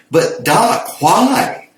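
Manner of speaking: high, rising plaint to the doctor; puzzled patient. Acted. Patient told to stop by his doctor, high puzzled plea. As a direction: plead it high, climbing on the last word.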